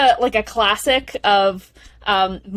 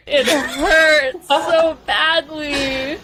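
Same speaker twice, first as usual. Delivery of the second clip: slow, higher, teary voice; real tearful ache; 4 semitones higher, much slower than usual. Real, not acted. Podcast host heartbroken recalling the reunion, tearful and slow. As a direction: let the heartbreak crack through, slowly. Moved by the hug scene.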